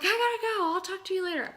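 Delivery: high, loud, frantic rush; freaking out. Retold or imitated. Hanging up on her aunt, high and frantic. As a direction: hurry off the phone in a frenzy. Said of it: "Freaking out".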